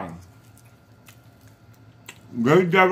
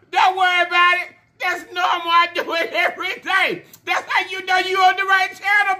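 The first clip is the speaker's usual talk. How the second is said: excited shout; 12 semitones higher than usual. Real, not acted. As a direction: yell the welcome with big excitement. Hyped welcome to new viewers.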